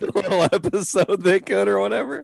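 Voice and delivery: high, giggly mock title; laughing. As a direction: laugh through a joke title. Joking title.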